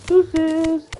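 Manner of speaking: high sing-song deuces; playful high farewell. Retold or imitated. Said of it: "What's that shit [name] said".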